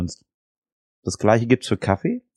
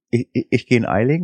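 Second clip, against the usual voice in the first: ordinary talk, nothing marked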